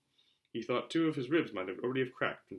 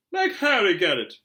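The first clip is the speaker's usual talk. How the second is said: higher, louder, slow whine; whiny Dudley; 7 semitones higher, 10 dB louder, slower than usual. Acted. Dudley refusing his dad, a loud slow whine. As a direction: whine like a spoiled boy.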